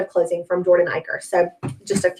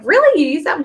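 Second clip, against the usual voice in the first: high, louder incredulous "really?"; retold surprise; 7 semitones higher, 4 dB louder than usual. Seller questioning a friend's placemat choice, surprised. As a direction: react in disbelief, pitch leaping up.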